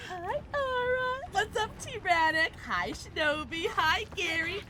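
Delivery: high, drawn-out, overjoyed greetings; gushing squeal. Real, not acted. Streamer welcoming friends one by one, gushing squeal. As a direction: squeal each name with affection.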